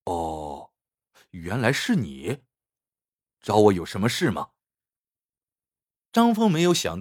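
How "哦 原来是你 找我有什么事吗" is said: respond without any joy of reunion; cool, distant low voice; cold, indifferent. A narrator's reading. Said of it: "繁星的语气那么冷淡".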